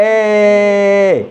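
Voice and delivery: E held very long as "ay"; sound demo. Shown as a demonstration. Teacher gives Mohamed the sound, long drawn-out é. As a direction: sustain E as one even é. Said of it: "qui nous donne le son".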